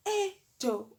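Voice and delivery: high exclamation; high exasperated call; exasperated eh Joe. Retold or imitated. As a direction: cry out in disbelief. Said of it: "I'm like".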